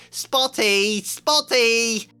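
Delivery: loud high jeering cry; taunting yell. Acted. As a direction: chase her yelling the nickname.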